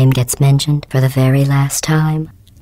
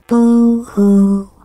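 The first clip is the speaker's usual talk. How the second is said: mock crying; 7 semitones higher, 3 dB louder than usual. Mocking the listener's complaints.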